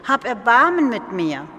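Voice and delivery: loud plea for mercy; calling out pleading. Retold or imitated. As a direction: beg at full voice across the street. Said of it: "rief ich".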